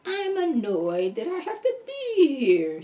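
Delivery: put-on a girl sulking about being there; sullen girl voice. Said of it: "four or five different voices".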